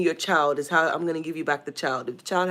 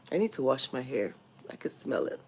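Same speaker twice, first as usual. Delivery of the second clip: whisper; 4 semitones lower, 8 dB quieter than usual. Real, not acted. Embarrassed aside about unwashed hair.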